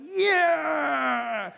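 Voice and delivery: high, drawn-out victory shout; triumphant cheer. Retold or imitated. Driver celebrating a successful swerve, long triumphant shout. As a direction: cheer yourself on like a champion.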